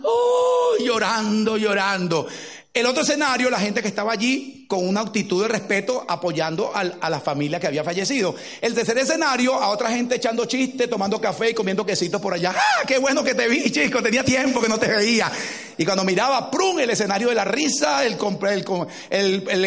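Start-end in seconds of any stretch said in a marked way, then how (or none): 0.0-0.7 high, loud mock wailing oh. Imitating mourners beside the coffin, a hammed-up wail. 0.8-1.6 mock weeping cry. Mourners weeping beside the coffin. 12.6-15.3 higher, louder jovial greeting; chatty guest. Retold or imitated. Imitating a guest chatting at the wake, jovial.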